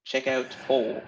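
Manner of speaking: playful put-on Irish accent; lilting Irish voice for the outro plug. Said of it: "That's my really good Irish accent".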